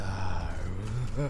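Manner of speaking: soft low chuckle; quiet amused chuckle; short dark chuckle. Acted. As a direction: chuckle to yourself. Villain's short amused laugh.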